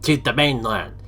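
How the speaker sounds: loud drill-sergeant bellow; hulking boss barking. Acted. Huge leader ordering his minions to find the hero, loud barking. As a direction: bark orders at the troops like a brute. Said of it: "Even a drill sergeant would quiver".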